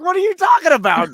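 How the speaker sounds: a sudden incredulous shout. Retold or imitated. Uncle reacting to niece loving her accident, incredulous high shout. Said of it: "And I was like".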